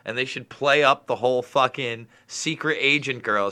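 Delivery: normal talking voice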